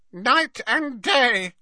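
slow, high croak; croaking house elf. A narrator's reading. House elf telling of his watch, in a slow high croak. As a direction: croak it out, drawn long. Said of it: "croaked Creature".

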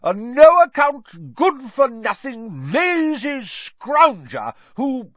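high, loud, slow spitting insults; loud high contempt; Aunt Marge, scornful. A narrator's reading. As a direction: drag out each insult with disgust.

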